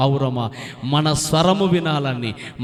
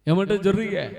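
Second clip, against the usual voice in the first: normal talking voice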